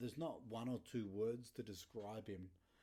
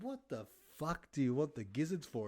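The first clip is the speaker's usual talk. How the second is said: incredulous question; 4 semitones higher, 7 dB louder than usual. Retold or imitated. Son asking incredulously.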